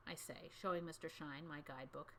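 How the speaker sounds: ordinary delivery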